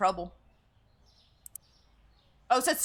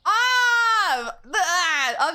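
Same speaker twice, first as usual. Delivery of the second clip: frustrated cry; 7 semitones higher, 7 dB louder, much slower than usual. Real, not acted. Misses goal by one.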